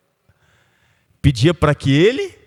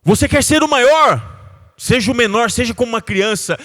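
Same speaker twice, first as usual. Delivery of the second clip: louder, higher impassioned exhortation; fiery preaching; 6 semitones higher, 3 dB louder than usual. Acted. A pastor urging the congregation to forgive, preached full force. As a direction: preach it with rising fire.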